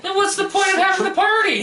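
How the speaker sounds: high, loud exasperated yell; indignant high-pitched outburst; mock outrage. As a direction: cry out in comic exasperation. Mock outraged outburst about the plot.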